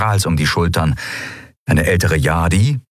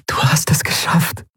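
higher, airy whisper into the ear; 10 semitones higher than usual. A narrator's reading. She embraces him and whispers in his ear. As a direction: whisper it in relieved wonder. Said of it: "flüsterte sie ihm ins Ohr".

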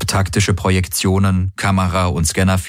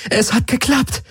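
cheering, pitched up high; jubilant. Acted. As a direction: burst out in high delight. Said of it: "Kirai fiel Galen jubelnd um den Hals".

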